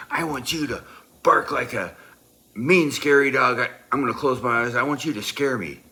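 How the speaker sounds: loud, high-pitched shouted order; mock-fierce demand. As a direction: shout a demand like an over-the-top drill. I slam my hand on the desk.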